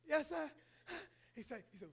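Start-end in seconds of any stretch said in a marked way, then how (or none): 0.1-0.5 put-on little boy voice. Son answers father.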